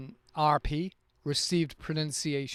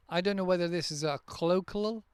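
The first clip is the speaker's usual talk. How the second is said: ordinary talk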